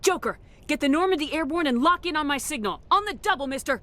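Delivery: urgent orders. Acted. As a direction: snap orders at the pilot, no time to lose.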